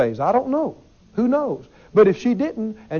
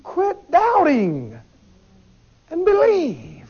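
exasperated plea; 7 semitones higher, much slower than usual. Retold or imitated. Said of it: "you just want to shake people and go".

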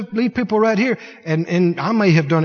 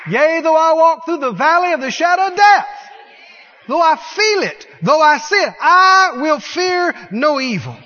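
defiant declaration; 7 semitones higher than usual. Shown as a demonstration. Preacher voicing the believer defying the devil, shouting. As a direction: roar your refusal of fear. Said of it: "You say".

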